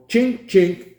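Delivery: high mock clicking of the camera shutter; imitated clicks. Pilot imitates the professor's device clicking, high and light. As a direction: mimic the slide cover snapping open, high pitched. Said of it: "he would sit there and he would go".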